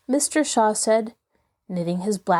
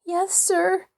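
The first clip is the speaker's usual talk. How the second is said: much higher, small timid reply; meek; 10 semitones higher than usual. A narrator's reading. Fanny answering her stern father, meek and much higher. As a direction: answer meekly, trying not to provoke him. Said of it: "answered a meek voice".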